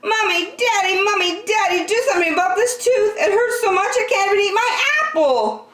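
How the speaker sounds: put-on high, fast child's wail; yelling child. A narrator's reading. Andrew wailing to his parents about the tooth. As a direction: cry out for both parents, near tears. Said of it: "and he yelled".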